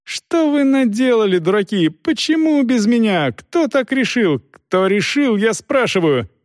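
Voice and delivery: high-pitched captain's tirade; outraged yelling. A narrator's reading. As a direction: demand answers in a high, strained cry. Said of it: "заорал Пруф".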